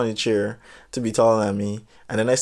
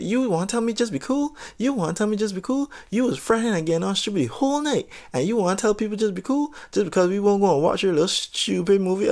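higher, mock-whiny girl voice throwing it back; 7 semitones higher than usual. Mocking the girl's retort to the storyteller, higher and whiny. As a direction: imitate her indignant comeback, pitched up.

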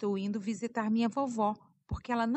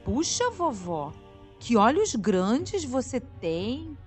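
amazed; 3 semitones higher, 6 dB louder, slower than usual. Acted. Girl marvels at grandma.

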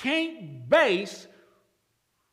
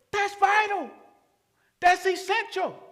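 higher, ringing emphasis on vital; emphatic answer; 11 semitones higher than usual. Real, not acted. Preacher answering a doubter, emphatic and high. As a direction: drive home the answer.

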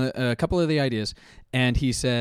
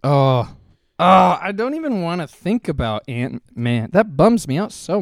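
higher, louder, slow lament; dismayed groan; 5 semitones higher, 7 dB louder, slower than usual. Real, not acted. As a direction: whine it out in dismay. Groaning about Ant-Man.

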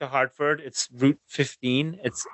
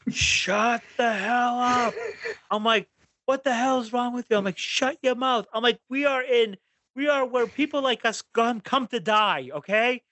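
higher, angry retold yell; yelling at the kids; 8 semitones higher than usual. Dad losing it at his arguing kids in the car, angry yell. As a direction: snap at the squabbling kids in the back. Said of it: "that's when I just lost it".

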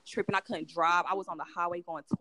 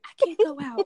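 high, loud mock wail; scared friend; 10 semitones higher, 9 dB louder than usual. Imitating her high friend refusing to go out, mock scared. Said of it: "She was scared of".

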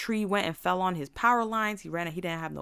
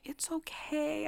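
high, soft, drawn-out reassurance; reassuring teacher; 10 semitones higher, 5 dB quieter, slower than usual. Retold or imitated. Teacher excusing a student who lied, softly reassuring. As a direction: soothe sweetly, stretched out and high.

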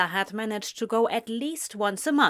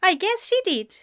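high, louder reply just after a laugh; amused; 11 semitones higher, 5 dB louder than usual. A narrator's reading. Anathema admitting it to Ezra, amused. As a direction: answer on the tail of a laugh. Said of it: "Anathema laughed".

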